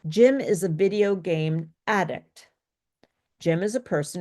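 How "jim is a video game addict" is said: "addict" stressed on its first syllable, AD; noun stress. Shown as a demonstration. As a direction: model sentence landing on ADdict. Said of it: "on the first syllable".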